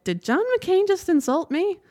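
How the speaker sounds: high-pitched retold disbelief; incredulous reaction. Telling listeners how the senator's remark stalled her, incredulous. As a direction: voice leaps up in stunned questioning. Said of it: "'cause I'm like".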